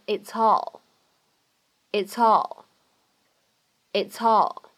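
"it's all" linked, the s carried onto "all"; linked words. Shown as a demonstration. Teacher demonstrating liaison, "it's all" run together. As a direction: glide from "it's" straight into "all" as one word. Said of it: "我们可以连读".

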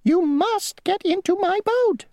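very high, squeaky insect voice; a tiny, prim rule-keeper; a tiny mosquito insisting on his rule. Acted. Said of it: "said the mosquito".

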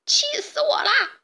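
shrieked high, breaking into a cry; a robber boss screaming in rage; furious shriek. Acted. Baba yells at the hens in fury, high shriek. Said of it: "强盗巴巴气得大叫".

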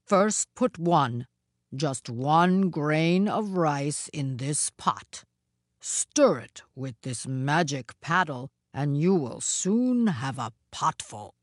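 low, slow ogre voice; deliberate ogre lesson; wicked Oni, instructing. Acted. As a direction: explain it slowly, down low. Oni gives cooking instructions.

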